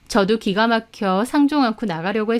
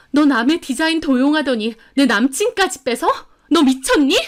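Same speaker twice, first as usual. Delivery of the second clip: higher, louder indignant accusation; furious; 5 semitones higher, 3 dB louder than usual. A narrator's reading. Betrayed woman turning on rival, furious. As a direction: lash out at her, pitched up. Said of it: "저는 화가 머리끝까지 나서".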